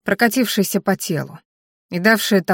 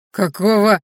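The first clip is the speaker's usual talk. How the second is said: unsure exclamation; 4 dB louder than usual. Acted. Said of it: "неуверенно воскликнул один из мужчин".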